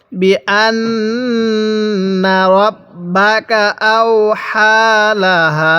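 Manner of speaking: very slow, drawn-out syllables; model recitation. Shown as a demonstration. Teacher models Quran words for learners, slow measured recitation. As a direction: stretch each long vowel evenly. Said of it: "কিভাবে পড়ব".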